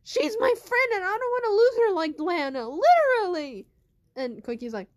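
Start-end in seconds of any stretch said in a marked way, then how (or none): 0.0-3.7 loud, high protest; upset outburst. Acted. Naomi protests to Koiki that she cannot lose her friend, loud and hurt. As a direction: shout it out, hurt and defensive. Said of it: "Naomi's like".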